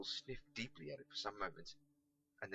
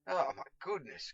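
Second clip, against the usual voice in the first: groan; 5 semitones higher, 8 dB louder than usual. Acted. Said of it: "groaned Pickle".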